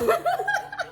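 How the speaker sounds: high burst of laughter. Real, not acted. Cracking up at the mom's joke, high laughter.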